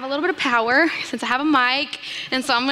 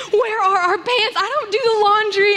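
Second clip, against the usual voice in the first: frantic high; 8 semitones higher, 3 dB louder than usual. Retold or imitated. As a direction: panic out loud, much higher. Frantic search.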